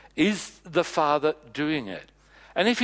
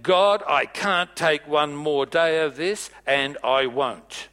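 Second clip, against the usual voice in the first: loud, slow, fed-up outburst; retelling a loud complaint to God; exasperated shout at God; 5 dB louder, slower than usual. Retold or imitated. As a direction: throw it up at heaven, drawn out and loud. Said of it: "I said".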